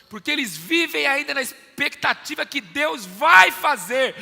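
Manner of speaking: loud, high sermon shouting; preaching at full force. Acted. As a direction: hammer the point home to the church. (pregação gritada com fervor)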